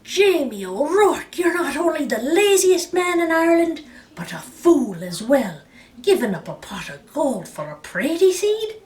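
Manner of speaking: wife berating lazy Jamie, loud; furious. A narrator's reading. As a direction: tear into him. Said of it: "she was furious".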